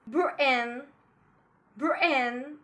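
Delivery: "Britain" with a catch in place of T; glottal stop. Shown as a demonstration. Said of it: "We use glottal stop often between two vowels".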